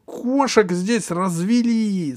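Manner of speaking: high, slow whiny princess; capricious voice. Acted. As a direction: whine like a spoiled princess. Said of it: "сказала принцесса капризным голосом".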